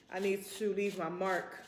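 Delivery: usual speaking voice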